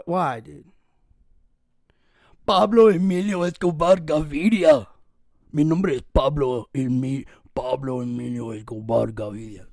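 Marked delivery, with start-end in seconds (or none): 2.5-9.7 hoarse, louder Spanish gangster name; Pablo Escobar voice. Retold or imitated. Showing listeners his rasp sounds like Escobar. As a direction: roll out the full name like the kingpin. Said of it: "That's what I sound like".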